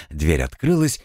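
usual speaking voice

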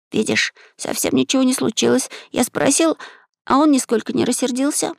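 put-on high-pitched boy, slightly amused; high boyish reassurance; light smirk. A narrator's reading. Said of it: "Максим усмехнулся, произнося эти слова".

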